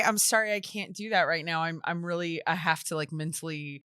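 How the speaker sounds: soft, low, heartfelt apology; quiet and sincere. Retold or imitated. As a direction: say it gently, almost under your breath. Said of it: "And I had to say".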